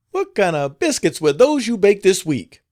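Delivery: high, loud furious scream. A narrator's reading. Madam confronts the cook over the biscuits, screaming. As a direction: scream the accusation in a rage. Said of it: "as she screamed out".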